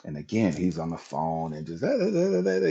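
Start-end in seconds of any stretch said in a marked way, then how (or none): 1.8-2.7 high-pitched mocking feminine chatter; feminine man imitation. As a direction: mimic a feminine man prattling on the phone. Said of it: "just real feminine".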